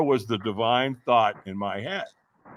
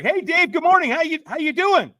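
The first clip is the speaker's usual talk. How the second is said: chipper caller; 4 dB louder than usual. Retold or imitated. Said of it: "And he's like".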